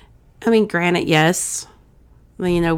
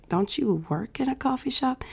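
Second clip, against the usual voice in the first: retold wifely disbelief, higher and softer; mock-baffled challenge to a husband; incredulous question; 7 semitones higher, 8 dB quieter than usual. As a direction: question him in quiet, high-pitched disbelief. Retold challenge to husband over coffee.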